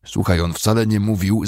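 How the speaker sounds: ordinary delivery, nothing marked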